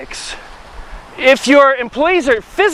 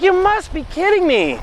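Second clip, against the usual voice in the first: incredulous; 7 semitones higher than usual. Retold or imitated. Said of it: "I'm like".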